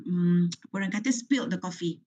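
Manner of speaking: ordinary talk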